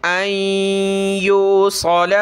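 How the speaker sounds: अय्यूसल with a thick, heavy स; heavy saad. Shown as a demonstration. Teacher showing students a heavy saad. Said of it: "स्वाद को मोटा करें".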